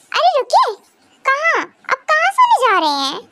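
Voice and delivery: high, urgent call to wait; startled stop. Acted. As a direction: catch him before he walks off. She stops him leaving.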